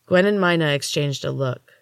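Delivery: ordinary delivery, nothing marked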